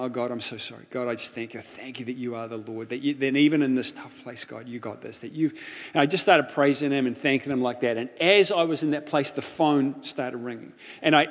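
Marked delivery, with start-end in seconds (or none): 0.0-5.5 soft, low, contrite prayer; humble prayer. Retold or imitated. Young man on his knees thanking God, soft and low. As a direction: pray quietly on your knees. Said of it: "started going".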